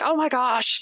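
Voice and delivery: mock shock.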